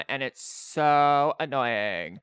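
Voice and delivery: put-on slow valley girl drag on every syllable; valley girl drawl. Shown as a demonstration. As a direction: stretch the last syllable like a preppy valley girl. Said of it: "They all extend the last syllable".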